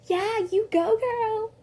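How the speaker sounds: put-on high, loud sassy girl cheer. [name] cheering on the fight, in a sassy voice. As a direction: hype her up like a sassy friend. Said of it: "Okay, not like that".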